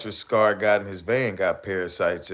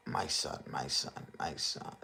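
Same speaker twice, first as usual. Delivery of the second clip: soft fading whisper; hushed doting whisper; mock tender whisper; 7 semitones lower, 11 dB quieter than usual. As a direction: whisper it like cradling a baby. Mocking tender whisper of father.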